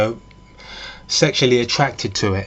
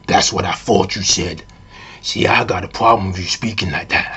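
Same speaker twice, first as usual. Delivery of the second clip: low put-on man's voice; mock deep, manly voice breaking cover; suddenly changed voice; 4 semitones lower than usual. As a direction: drop deep to confront the listener. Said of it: "her voice suddenly changes".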